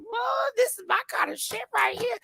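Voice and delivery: high thrilled shout; high-pitched excited whoop; excited squeal. Retold or imitated. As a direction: hype it up like a delighted fan. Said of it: "I was like".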